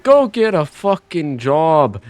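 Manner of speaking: a hammed-up Irish mum scolding her son; Irish accent. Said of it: "I envision it as Irish".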